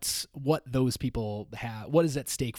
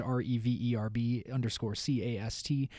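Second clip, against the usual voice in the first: letter by letter, clearly spaced; spelling it out. Shown as a demonstration. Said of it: "We are on Twitter at Reverbcast".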